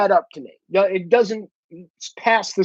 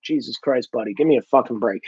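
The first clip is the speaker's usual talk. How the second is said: lower, softer, weary dismissal; exasperated retort; 7 semitones lower, 4 dB quieter than usual. Retold or imitated. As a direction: drop the pitch and brush it off, fed up. Exasperated retort to mimicked protesters.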